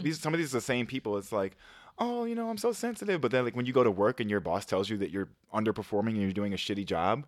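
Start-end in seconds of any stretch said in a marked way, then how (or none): 2.0-3.2 high-pitched, softly put-on delicate voice; mock sensitive. Mocking people who plead sensitivity, high mock-delicate voice. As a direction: mimic an oversensitive person, pitched way up.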